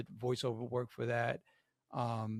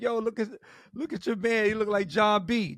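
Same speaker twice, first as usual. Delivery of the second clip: cocky teasing; 8 semitones higher, 7 dB louder than usual. Retold or imitated. Cocky Kobe mocking to his boys.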